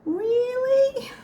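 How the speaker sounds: very high, squeaked "Really?"; over-the-top incredulous "Really?"; exaggerated mock disbelief. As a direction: push REALLY way up high and hold it there. Repeats the disbelief, much higher.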